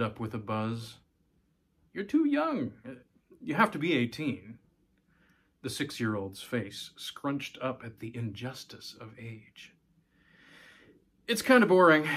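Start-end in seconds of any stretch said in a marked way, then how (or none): 1.9-4.6 higher, gently explaining dad; a hint of a patient father; lightly voiced father. Father telling his six-year-old she can't vote, mild. 11.3-12.1 louder, higher dismissive dad; offhand. Acted. Father telling daughter voting is boring, offhand.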